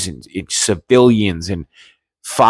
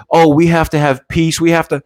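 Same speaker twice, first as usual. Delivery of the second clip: mock whiny plea; 6 semitones higher than usual. Mocking the critics' plea for peace.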